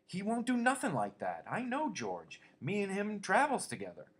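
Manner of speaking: high, loud and frantic. A narrator's reading. Lenny frantically defends George to the rabbit, high-pitched. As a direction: plead in a panic, pitched high. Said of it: "Lenny cried frantically".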